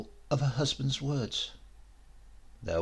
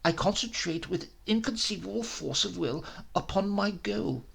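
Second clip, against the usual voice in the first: a lightly voiced, raised-pitch scientist pleading his cause; obsessed professor; 8 semitones higher than usual. Professor to Giuseppe about his experiment, lightly voiced fervour. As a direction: let the professor's fervour lift the voice a little.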